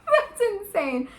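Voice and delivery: high, drawn-out laughing amazement; laughing disbelief. Real, not acted. Reacting to a romantic line, laughing in disbelief.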